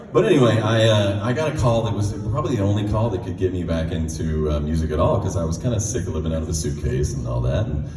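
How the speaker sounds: ordinary talk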